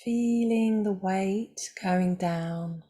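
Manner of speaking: calm, unhurried voice with long pauses; guided meditation. Acted. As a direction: guide them gently into stillness, leaving space between phrases.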